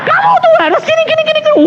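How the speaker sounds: very high-pitched telling-off; mock scolding. Pastor gladly scolds the weeping visitor, mock angry. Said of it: "saya malah seneng tak marahin".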